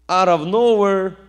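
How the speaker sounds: loud, high punch on nowhere; preaching at full force; emphatic preaching. Real, not acted. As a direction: hit it hard to build.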